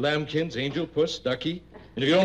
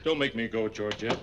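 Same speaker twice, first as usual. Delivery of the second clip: low pleading; 8 semitones lower, 5 dB quieter than usual. Acted. Begging in a low voice.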